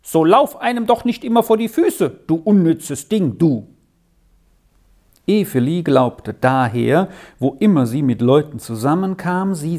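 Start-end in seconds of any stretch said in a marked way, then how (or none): 0.0-3.7 higher-pitched scolding call, narrated. A narrator's reading. Brothers calling at their little sister as she comes near, scolding. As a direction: call it out like irritated brothers, mildly. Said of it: "So riefen sie dann immer schon".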